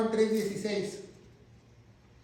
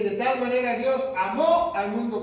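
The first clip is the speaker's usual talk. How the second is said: "amó" louder, stressed on its final ó; acento en "amó"; 3 semitones higher, 5 dB louder than usual. Shown as a demonstration. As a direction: hit the last syllable of "amó" hard. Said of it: "con acento en la O". (acento fuerte en la ó de "amó")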